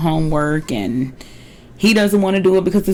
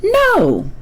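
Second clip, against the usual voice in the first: shrill, high mock No of a refusal; squeaky impression of a firm no; Robin refusing. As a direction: imitate a flat-out refusal. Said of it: "Robin goes".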